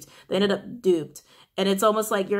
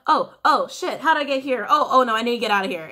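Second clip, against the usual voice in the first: louder, higher mock panic; acted panic; 3 semitones higher, 6 dB louder than usual. Reader acting someone who walked right into pain, mock panic. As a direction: blurt it out as if desperate to escape. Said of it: "someone walked right in and instantly was like".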